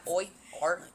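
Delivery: put-on Australian accent; mock Aussie bloke impression; Tynan. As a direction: exaggerate the Australian vowels. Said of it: "He sounds".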